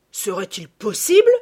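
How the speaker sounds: loud, indignant disbelief; scandalised countrywoman's exclamation; indignant cry. A narrator's reading. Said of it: "Elle prit un air indigné".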